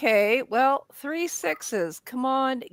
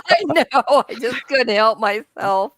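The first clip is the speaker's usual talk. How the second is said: laughing; 3 semitones higher, 9 dB louder than usual. Real, not acted. Laughing after teasing friend.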